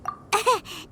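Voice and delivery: embarrassed little laugh into silence; awkward laugh. Acted. Paimon covering an awkward silence, embarrassed laugh. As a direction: laugh to fill the awkward pause.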